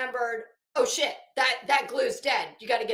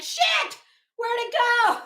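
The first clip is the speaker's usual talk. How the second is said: high, sudden panicked "Shit"; a squeaky jolt of alarm at the lost nail; startled yelp; 10 semitones higher, 5 dB louder than usual. Retold or imitated. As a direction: pitch jumps way up in mock panic.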